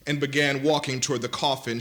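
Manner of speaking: ordinary talk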